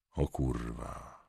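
very soft, slow, dropped low; a stunned, hushed swear; muttered curse. Acted. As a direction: curse under your breath in shock. Cop swears at shocking news.

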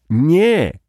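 loud, high exclamation; shouted no. A narrator's reading. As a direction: cry it out in alarm. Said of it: "zakrzyknęły obie kobiety jednym głosem".

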